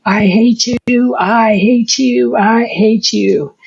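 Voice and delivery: high, slow, drawn-out cry of I hate you; reliving a scream of rage at God; retold screaming.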